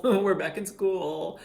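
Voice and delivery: giggling slowly; laughing. Real, not acted. Laughing at the memory.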